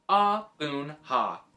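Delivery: Ah Boon Ha said slowly, every syllable clear. A narrator's reading. Boy says the owner's name to the taxi man, slow and clear. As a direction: spell out the name for the driver. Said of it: "very slowly and clearly".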